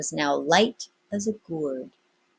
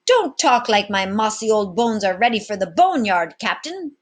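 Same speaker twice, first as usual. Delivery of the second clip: put-on old sailor protesting; 5 semitones higher, 7 dB louder than usual.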